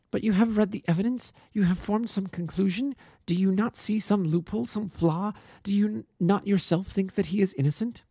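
put-on high, urgent young woman defending her friend; earnest young woman. A narrator's reading. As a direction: plead with fierce conviction. Miss Turner presses Holmes.

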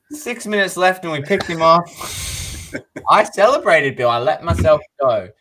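high, loud, incredulous retelling; exasperated excitement. Real, not acted. Fan telling Bill of celebrating too soon, loud exasperation. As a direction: relive the false hope loudly with a laugh. Reliving dashed celebration, laughing.

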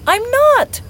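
high, loud mock-indignant protest; playful protest. Surprised partner told not to peek, protesting. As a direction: protest your innocence, voice jumping up.

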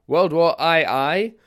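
"World War I I?" drawn out, high and loud, rising; incredulous mockery. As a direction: scoff at "World War I I" slowly. Mocking repeat of the name.

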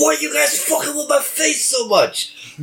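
high-pitched, whiny-sounding complaint in character; enemy's outburst. Acted. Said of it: "he's like".

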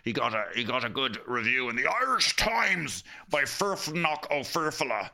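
ordinary talk

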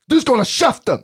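sharp high shout at them; mock cop shout. Rude cop yelling at drunk teens, high shout. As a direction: bark it like an angry cop.

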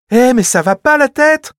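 very high, loud outraged yell; child's shout. Acted. Clara shouts as Fritz pulls the toy, very high and loud. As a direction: scream at your brother. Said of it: "cria Clara".

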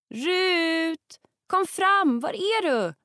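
high, loud call into the forest. Acted. Hetty calls for missing Rut, loud call. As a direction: shout for her lost friend among the trees. Calling for lost friend.

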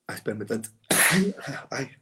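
holding back a sneeze; about to sneeze. Real, not acted. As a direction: caught by a sneeze. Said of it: "Me dio el estornudo".

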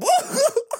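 short high squeal of laughter. Real, not acted. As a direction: burst out laughing.

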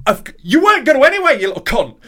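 high, loud retold yell; finally snapping at the pharmacist, a loud high yell; shouted insult.

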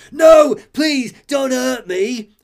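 high, loud pleading whine; begging Penguin. Retold or imitated. Penguin begs Batman for mercy, high squealing plea. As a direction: beg for your life in a squeal. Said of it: "Penguin starts to beg".

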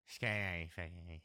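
low, soft ASMR close whisper; mock ASMR, very close and low; right in your ear. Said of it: "A S M R right in your ears".

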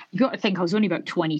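normal talking voice

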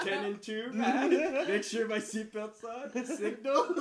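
laughing mock rule-follower reciting the driving checklist; mock model driver. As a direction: play the overly careful driver for laughs. Mocks own careful driving.

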